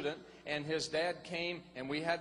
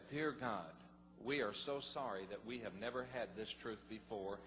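softer, lower contrite prayer; hushed prayer; 7 semitones lower, 9 dB quieter than usual. Retold or imitated. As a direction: pray it low and humble. Retold prayer with wife.

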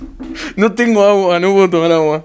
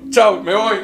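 normal talking voice

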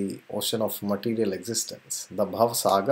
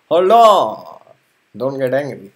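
calling out to stop; 10 dB louder, slower than usual. Acted. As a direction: call out to stop him. Ram calming angry Lakshman.

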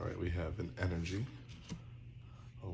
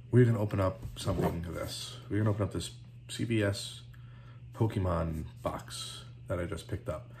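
ordinary talk, nothing marked

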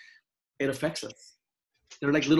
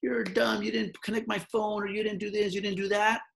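high, whiny complaining customer; rude customer; 8 semitones higher than usual. Retold or imitated. Mocking a rude customer who complains at her business. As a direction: mimic an annoyed customer griping. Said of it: "somebody comes into my restaurant".

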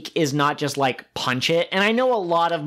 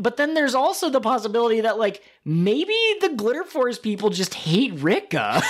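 ordinary talk

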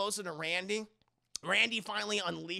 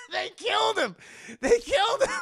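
high, loud, tearful outcry; mock-horrified wail; 12 semitones higher, 6 dB louder than usual. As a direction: wail it in exaggerated grief.

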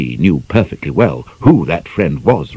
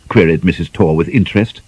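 ordinary delivery, nothing marked

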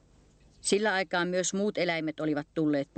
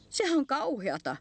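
high, slow little voice; shy hedgehog; 8 semitones higher than usual. A narrator's reading. Hedgehog reacts to the bear's tale, shy high voice. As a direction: say it slowly and high, shyly. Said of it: "sanoi ujo siili".

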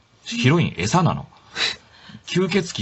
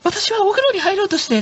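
high mock voice of a sleepy guy; sleepy character. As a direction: squeak it out as the dozy character. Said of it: "って言って".

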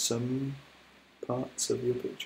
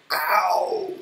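higher, louder yelp of pain; elephant's ow; 8 semitones higher, 7 dB louder than usual. Acted. Elephant bitten by the crocodile, pained yelp. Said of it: "said Trunky".

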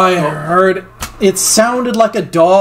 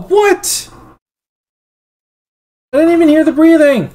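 panicking gamer; 9 semitones higher than usual. Real, not acted. Panicked disbelief.

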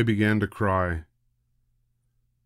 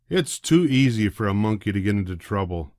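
ordinary delivery

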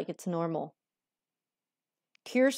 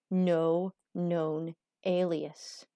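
slow drawn out; 5 semitones lower, 4 dB quieter, much slower than usual. Pretend. Repeating phrase slowly.

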